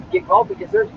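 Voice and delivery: high, soft squeaky voice. Pretend. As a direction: pitch it way up, softly. High squeaky mock lines.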